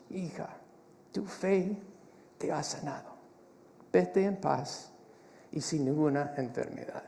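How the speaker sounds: soft, hushed and gentle, slowing on "vete en paz"; quiet reassuring voice of Jesus; tender Jesus. Acted. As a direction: speak it like a tender blessing to a healed daughter. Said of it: "me encanta la ternura de Jesús".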